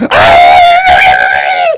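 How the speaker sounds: loud, wailing cry of outrage; pained cry. Retold or imitated. Dismissed catechist wails out loud. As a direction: let out an offended cry.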